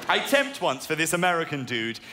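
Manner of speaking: ordinary talk, nothing marked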